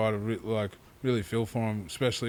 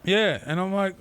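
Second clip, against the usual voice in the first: ordinary talk, nothing marked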